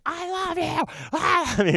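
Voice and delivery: high, squeaky declaration of love; gushing young fan. Retold or imitated. Retold child fan gushing at star.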